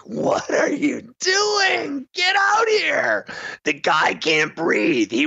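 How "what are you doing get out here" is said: high, slow squealing mock yell. Players yelling at the ambulance crew, high squealed yell. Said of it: "yelling at the ambulance people".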